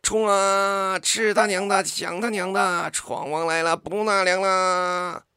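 ants roaring a battle chant; wild shouting. Acted. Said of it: "它们狂喊".

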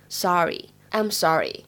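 American "sorry" with an open, longer ah vowel; American pronunciation. Shown as a demonstration. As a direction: say "sorry" the American way, mouth wider, like "sah-ree". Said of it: "美式的发音当中的话呢".

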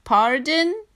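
high, puzzled giraffe asking back; a polite giraffe who didn't hear. Acted. Giraffe voiced in picture book.

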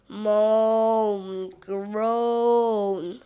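drawn-out groaning monster voice; monster moan. Acted. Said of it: "says the monster".

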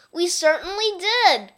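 put-on children loudly glad their teacher is back; excited cry. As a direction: call it out like happy kids. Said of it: "cried all the kids".

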